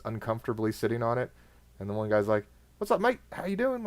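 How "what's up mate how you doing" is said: high, cheery British greeting; chipper British accent. Retold or imitated. British guard greets him at the gate, chipper British accent. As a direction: imitate a British guard, bright and friendly. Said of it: "very thick British accent, all chipper".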